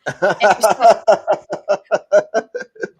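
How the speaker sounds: a sudden high, loud laugh; real loud laugh at the joke; burst of high laughter. Real, not acted. Host reacts to potato joke.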